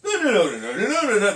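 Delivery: rattled-off run of syllables; mouth running on. Acted. Man shows how his mouth runs away with him, babbling. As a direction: babble on without stopping. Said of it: "My mouth runs on".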